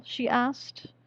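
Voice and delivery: ordinary delivery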